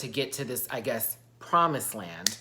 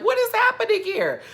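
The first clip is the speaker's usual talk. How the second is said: high, loud mock outcry; shocked exclamation; 7 dB louder than usual. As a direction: cry out in baffled disbelief, pitch way up. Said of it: "I was like".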